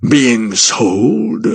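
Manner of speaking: a touch of foreboding, slow and drawn out; mildly ominous warning from an old guardian; understated dread, slowed down. Acted. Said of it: "a note of terrible foreboding in his voice".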